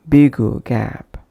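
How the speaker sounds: slow BIG GAP with an "oo" between; extra vowel inserted. Shown as a demonstration. Teacher demonstrating a wrong pronunciation, extra vowel inserted. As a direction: stretch an "oo" after "big", as in "bigu gap". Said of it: "avoid bringing any other sound between".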